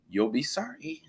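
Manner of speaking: mocking singsong; snide giggle. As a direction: tease under your breath. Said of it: "giggled Nightingale".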